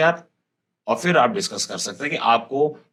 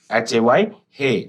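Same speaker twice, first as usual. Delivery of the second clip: usual speaking voice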